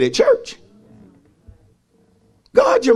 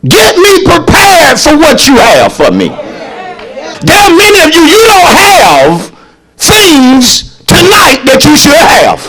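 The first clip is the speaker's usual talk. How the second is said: loud, high preaching shout; preaching at full force; 7 semitones higher, 9 dB louder than usual. Acted. Preacher shouting to the church at full force. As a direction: whoop it up to the congregation.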